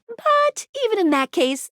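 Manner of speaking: ordinary talk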